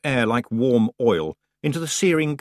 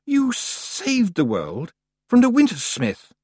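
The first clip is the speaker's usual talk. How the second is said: haughty goddess voice; 11 semitones higher, 3 dB louder, slower than usual. Acted. Summer Lady speaking, voiced.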